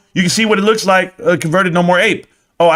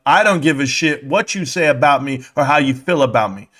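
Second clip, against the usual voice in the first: ordinary talk, nothing marked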